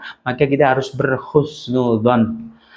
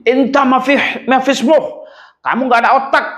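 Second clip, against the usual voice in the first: mock insult; 11 semitones higher than usual. Egyptian man insulting him.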